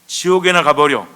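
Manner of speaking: loud, forceful acted insult; mock curse. Gesture's meaning in Greece, loud mock curse.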